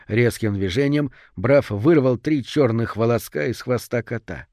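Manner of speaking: plain reading voice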